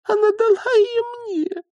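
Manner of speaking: very high little voice; squeaked insistence. Acted. Young witch holds out against her elders, squeaking. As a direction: repeat it in a thin high squeak.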